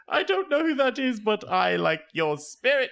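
high, laughing owl voice; high-pitched hooting amusement; amused owl. Acted. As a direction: chuckle it up high, slowing at the end. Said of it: "he's all".

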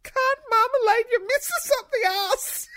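high squealing laugh through the words. Real, not acted. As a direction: laugh while reading it out. Reading a joke, laughing.

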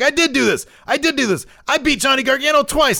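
hammed-up, squealing pitch of a pumped-up champion; imitated hyped-up. Rookie champion boasting back to the host, imitated all hyped up. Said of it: "all hyped up".

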